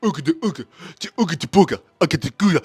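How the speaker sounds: loud, chanted ape babble "Ooga da ooga"; caveman Kong grunting. Retold or imitated. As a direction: play a dim-witted giant ape. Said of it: "Kong just running around going".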